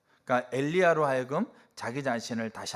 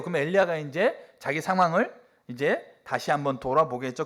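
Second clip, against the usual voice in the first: ordinary delivery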